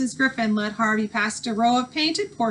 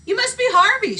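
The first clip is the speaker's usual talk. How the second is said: lightly voiced eager young girl, higher; a freckled girl greeting the newcomer, bright and higher; girl's voice; 11 semitones higher, 5 dB louder than usual. A narrator's reading. Girl character voiced by narrator.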